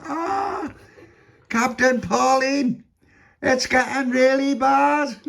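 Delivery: high, slow, drawn-out shout; calling out. A narrator's reading. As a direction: shout up to the captain over a storm. Calling to captain in a storm.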